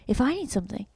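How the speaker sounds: high, indignant jealous scolding; jealous demand. Real, not acted. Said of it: "my jealousy".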